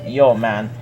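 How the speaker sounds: plain reading voice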